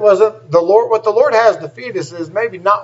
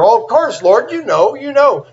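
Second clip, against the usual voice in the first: higher, protesting reply; acted eager insistence; insistent Peter; 7 semitones higher than usual. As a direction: plead that it is obvious.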